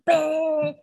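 ordinary talk

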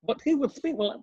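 high, lisping Chris Eubank voice; slippery, posh boxer talk; Chris Eubank impression. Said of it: "like some sort of slippery snake".

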